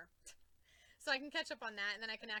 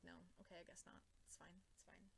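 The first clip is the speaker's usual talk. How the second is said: much softer, deflated self-talk; resigned mumble; 3 semitones lower, 17 dB quieter than usual. Retold or imitated. Giving up on the comic series, soft resigned mutter. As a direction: let it fade out in defeat.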